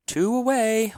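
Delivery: very high, loud wail; jagged high wail; wailing call. A narrator's reading. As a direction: cry it out near hysterical. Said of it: "wailed Finesse".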